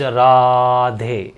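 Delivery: राधे said very slowly, the final vowel stretched long; pluta vowel demo. Shown as a demonstration. Teacher to students, stretching राधे to show a pluta vowel. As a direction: hold the last vowel of राधे three beats long. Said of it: "प्लुत का मतलब है ... लंबा repetition खींचना".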